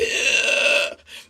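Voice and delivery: sudden loud frightened intake of breath; retold sharp gasp. Retelling freezing in bed with her partner, a sharp gasp. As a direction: gasp as if startled in the moment. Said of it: "all of a sudden I was like".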